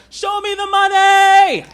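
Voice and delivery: high, slow, all-out shout; Cuba Gooding Junior impression. Shown as a demonstration. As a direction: scream it with total joy. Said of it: "I tried Cuba Gooding Junior from Jerry Maguire".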